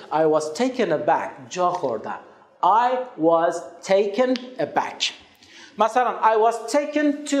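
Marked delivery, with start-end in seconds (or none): 2.6-5.0 slowly, word by word; deliberate, spaced-out teacher delivery; slow model sentence. Shown as a demonstration. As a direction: say it slowly and clearly for learners.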